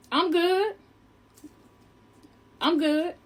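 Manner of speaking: high, lifted refusal with long gaps; polite refusal. Retold or imitated. Woman declining the man's hot coat, high polite refusal. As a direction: turn it down in a high, careful voice.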